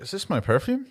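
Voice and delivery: puzzled mother. Acted. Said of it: "she must be looking like".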